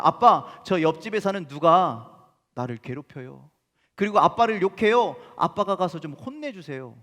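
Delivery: put-on loud, childlike plea to her father; a daughter complaining loudly to her dad; daughter pleading to dad. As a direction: tell on the neighbour kid, begging dad to step in. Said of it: "딸이 아빠에게 와서 이렇게 이야기한 겁니다".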